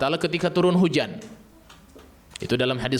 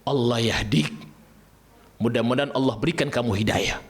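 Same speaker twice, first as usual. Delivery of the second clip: father's angry prayer. Retold or imitated. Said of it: "apa yang dikatakan oleh beliau".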